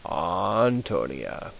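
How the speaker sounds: very slow Antonia with open a; slow model word. Shown as a demonstration. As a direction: stretch Antonia out. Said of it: "So it's".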